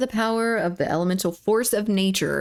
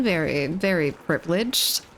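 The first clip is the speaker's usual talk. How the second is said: ordinary talk, nothing marked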